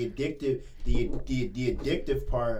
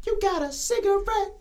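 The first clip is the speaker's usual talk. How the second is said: high beggar voice. Retold or imitated. Imitating a cigarette beggar.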